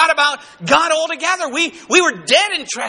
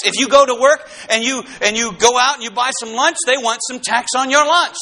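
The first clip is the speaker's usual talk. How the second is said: ordinary talk, nothing marked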